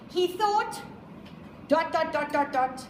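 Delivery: dot dot dot dot dot counted out in mock tedium; teasing a student's wrong quote; mocking student error. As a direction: spell out each dot of the too-long ellipsis. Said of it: "you give me ten dots instead of three".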